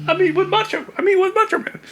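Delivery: giddy fan gushing; starstruck. Retold or imitated. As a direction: squeak it out starstruck. Starstruck high laughing.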